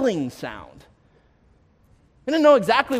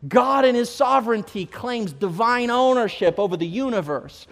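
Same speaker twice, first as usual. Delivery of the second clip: loud, slow and rising, much louder than the story; full-force preaching; 3 semitones higher, 6 dB louder, slower than usual. Acted. Pastor proclaiming God's ownership to the church, full-force preaching. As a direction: preach it out to the whole congregation.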